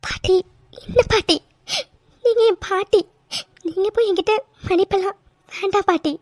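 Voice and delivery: high, tearful protest; crying. Acted. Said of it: "அழாதடா, அழாத". (அழுதபடி கெஞ்சும் குரல்)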